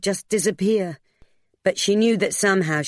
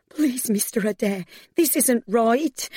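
high, distressed pleading, slowing down; cracking voice; 6 semitones higher than usual. A narrator's reading. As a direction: beg with the voice near breaking. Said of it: "her voice cracked".

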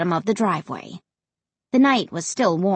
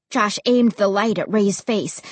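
ordinary delivery

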